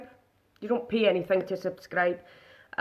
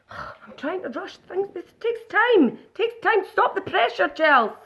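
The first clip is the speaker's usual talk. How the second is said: much higher, hammed-up fluster, drawn out at the start; mock dramatic plea; 9 semitones higher, 4 dB louder, slower than usual. Telling Chelsea to stop rushing her makeup, high hammed-up whine. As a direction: play the overwhelmed diva begging for patience.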